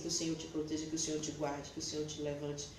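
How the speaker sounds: blessing. Retold or imitated. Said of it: "eu dizia assim".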